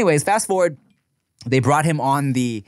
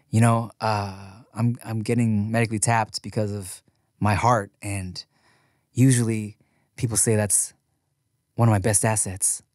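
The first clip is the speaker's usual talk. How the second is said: a lightly mocking, lower and softer earnest confession; corny heartfelt quote; 5 semitones lower, 4 dB quieter than usual. Host mocking a reality show contestant's sappy farewell, in a mildly hammed-up earnest voice. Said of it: "corny Lifetime movie quote".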